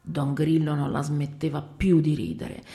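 ordinary delivery, nothing marked

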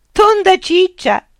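high, loud, slow laughing echo; mocking laughing repeat; bursting into laughter. A narrator's reading. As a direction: turn the tables with a laugh. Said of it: "scoppiando a sua volta in una risata".

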